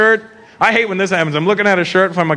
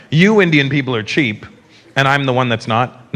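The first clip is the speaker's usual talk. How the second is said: a hint of a lower, condescending snob; smug snob; 7 semitones lower than usual. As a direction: look down your nose at them, lightly. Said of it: "It's not like I'm up here going".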